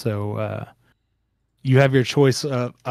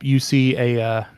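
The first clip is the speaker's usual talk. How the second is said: usual speaking voice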